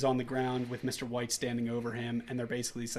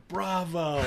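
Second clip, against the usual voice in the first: a hint of smug higher praise; lightly voiced sarcastic bravo; mock applause; 5 semitones higher than usual. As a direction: toss it off like a smug performer. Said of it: "And he's like".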